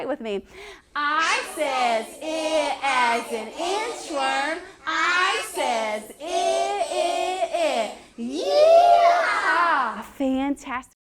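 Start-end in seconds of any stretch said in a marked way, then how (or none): 0.9-10.0 slow drawn chant, laughing, high yeehaw; cowboy voice. Shown as a demonstration. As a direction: chant like a cowboy riding a horse. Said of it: "We're gonna say it like a cowboy".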